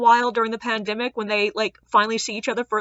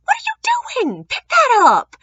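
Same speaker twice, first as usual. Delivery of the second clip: very high-pitched mock scolding, louder at the end; 5 dB louder than usual. Woman scolding her partner for tipping the trash, high mock outrage. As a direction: imitate an exasperated telling-off. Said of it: "slaps him upside the shoulder and goes".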